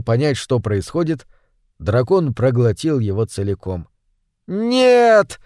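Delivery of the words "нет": a high ringing cry of no; desperate shout. A narrator's reading. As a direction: scream it to stop the horror. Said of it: "крикнула Женева".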